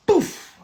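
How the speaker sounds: a sharp high burst for the slap; a loud mouth-made slap noise; slap sound. Retold or imitated. Recounting the stranger's slap to viewers, loud high pouf. Retelling being slapped.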